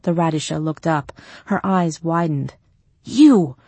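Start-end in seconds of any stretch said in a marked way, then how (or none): 3.0-3.6 startled loud recognition; a sudden shocked exclamation; surprised. A narrator's reading.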